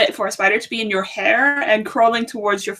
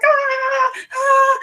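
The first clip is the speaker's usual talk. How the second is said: panicked squeal. Retold or imitated. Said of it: "And I was like".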